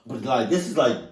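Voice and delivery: usual speaking voice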